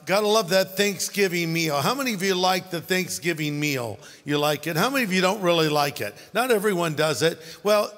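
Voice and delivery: usual speaking voice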